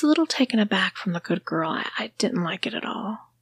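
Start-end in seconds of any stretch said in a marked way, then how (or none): none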